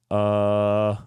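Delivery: drawn-out droning filler; uncomfortable singer's "uh". Retold or imitated. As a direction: imitate a stuck frontman stalling. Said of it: "wasn't comfortable".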